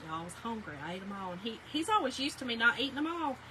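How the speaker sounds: normal talking voice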